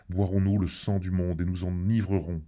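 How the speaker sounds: usual speaking voice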